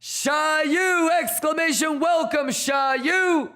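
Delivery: high, slow welcome shout; stretched-out shouted welcome; hype welcome. Real, not acted. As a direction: call the name out like a hype man. Welcoming viewer loudly.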